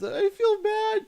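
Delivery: very high, drawn-out mock pity; high, stretched imitation voice; mock sympathy. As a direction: squeal it slowly as a put-on quote. Acted sympathy in retelling.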